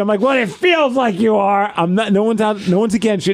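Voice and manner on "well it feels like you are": high, drawn-out protest, mildly yelled; retold yelling. Husband insisting to his wife during the retold row, mock yelling. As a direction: argue back slowly at a higher pitch. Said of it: "I'm yelling at her".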